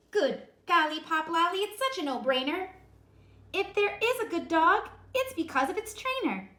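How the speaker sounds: high, loud, emphatic mother; preachy rhyming lecture; Mama voice. A narrator's reading. Mother lecturing her kitten about trainers, emphatic.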